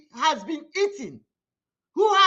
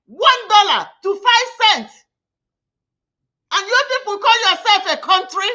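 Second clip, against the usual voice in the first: furious shout; 7 dB louder than usual. Real, not acted. Raging at the government over currency.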